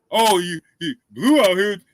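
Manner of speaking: a mocking take on the accuser's voice; lightly voiced mockery of the accuser; imitating the rival. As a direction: parody the guy spreading rumors. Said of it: "Man, that's what he said".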